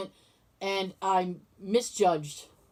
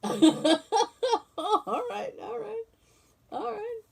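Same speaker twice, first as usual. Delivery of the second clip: laughing all right; 12 semitones higher, much slower than usual. Real, not acted. Laughing after a joke.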